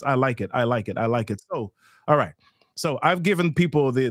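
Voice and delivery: usual speaking voice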